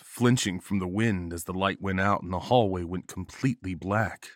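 usual speaking voice

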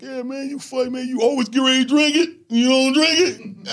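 high, loud teasing voice from another comic; mimicking a club guy. Comic in the club teasing him, high and loud. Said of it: "He was like".

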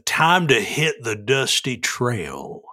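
slow cowboy drawl; unhurried, like a trail-worn cowboy; slow cowboy dialect. Shown as a demonstration. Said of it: "I will do this in dialect".

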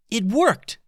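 high, loud cry of triumph; excited yell. A narrator's reading. Stella cheering her magic as the pirates' deck burns, yelling. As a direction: shout with glee. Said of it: "yelled Stella".

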